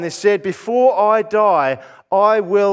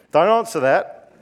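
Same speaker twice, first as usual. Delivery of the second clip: normal talking voice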